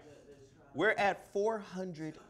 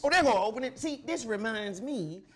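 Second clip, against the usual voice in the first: wrestling promo; 7 semitones higher, 6 dB louder than usual. Acted. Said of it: "it's a promo".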